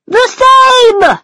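high, loud exclaimed answer; cried out. A narrator's reading. The brothers answer Alice together, crying out high and loud. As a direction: fling it back triumphantly. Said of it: "the brothers cried together".

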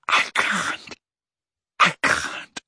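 whispered, breathless refusal; barely able to speak for fear; terrified whisper. A narrator's reading. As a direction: Dumbledore pleading in a whisper. Dumbledore pleading, terrified.

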